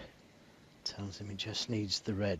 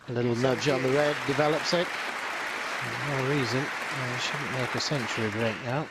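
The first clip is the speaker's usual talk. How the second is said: faster, louder, rising with the break; excited commentary; 3 semitones higher, 6 dB louder than usual. Real, not acted. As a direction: lift the voice as the shot lands. Commentator lifts voice during break.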